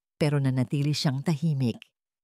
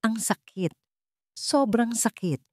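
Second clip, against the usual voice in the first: pained; 5 semitones higher than usual. A narrator's reading. Aurora's inner pain.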